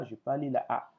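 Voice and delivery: usual speaking voice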